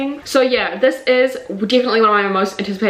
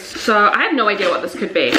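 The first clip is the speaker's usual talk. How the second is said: usual speaking voice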